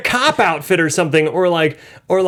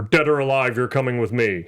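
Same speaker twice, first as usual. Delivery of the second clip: RoboCop voice; 5 semitones lower than usual. Retold or imitated. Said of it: "It's RoboCop".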